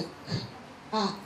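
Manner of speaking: cat broken into k, then at, with a gap; isolated k, pause, then at; segmented sounds. Shown as a demonstration. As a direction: sound out k, at as separate bits. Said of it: "Breaking the word cat down".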